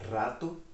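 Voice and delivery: "Rato" opened with a rolled R; strong Spanish R. Shown as a demonstration. Teacher repeats the Spanish word for learners, strong trilled R on "Rato". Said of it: "o R é bem forte em espanhol".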